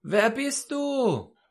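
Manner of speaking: high, slow, drawn-out call; calling upward. Retold or imitated. Hanging man asks the unseen voice above, high drawn-out call. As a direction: stretch the question up the cliff.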